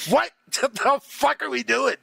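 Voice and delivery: words breaking into a real laugh. Real, not acted. Streamer laughing at gamers' contradiction. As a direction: laugh it out in disbelief.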